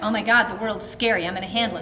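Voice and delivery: a hint of a stubborn dog; bossy scared dog voice. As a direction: voice a dog that panics, then takes charge. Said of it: "The cautious dominant dog is like".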